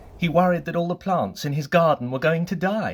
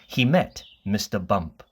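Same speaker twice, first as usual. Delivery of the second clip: plain reading voice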